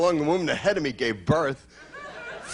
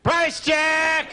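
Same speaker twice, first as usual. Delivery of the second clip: high shouted store announcement; loudspeaker call; 12 semitones higher than usual. Retold or imitated. Comic to crowd, mimicking a cashier's shouted price check. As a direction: bark it like a cashier over the intercom.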